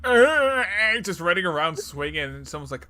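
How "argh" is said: high, loud, drawn-out "argh"; mock agonized groan. Imitating someone running around with an open skull, loud groan. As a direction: groan loudly like a wounded game character.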